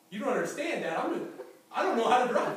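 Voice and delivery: half-laughing, begging Dad to see the danger; mock panic with a laugh; panicked plea. Panicked son begs dad.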